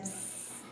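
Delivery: quiet voiceless "sss"; S hissing sound. Shown as a demonstration. Teacher showing the sound of S, soft hissed Ss. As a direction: sustain a soft snake-like S. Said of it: "o som do S é esse".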